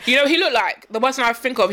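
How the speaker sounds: usual speaking voice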